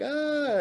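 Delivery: high-pitched imitated crowd scream; mock panicked scream.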